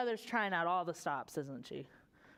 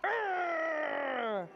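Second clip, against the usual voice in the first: growl; 5 semitones higher than usual. Shown as a demonstration. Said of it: "Can you guys do that?".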